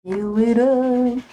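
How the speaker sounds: playful sing-song. Pretend. Streamer joking about her backpack, slow teasing drawl. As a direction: stretch it out playfully.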